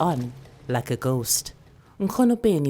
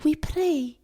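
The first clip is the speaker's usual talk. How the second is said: put-on very high little girl whisper; child whisper; 14 semitones higher than usual. Girl whispering back to grandmother.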